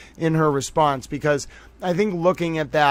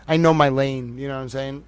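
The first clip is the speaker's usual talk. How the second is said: ordinary talk, nothing marked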